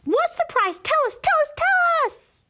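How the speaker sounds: put-on high eager kid shouts; excited calling out. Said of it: "we called out".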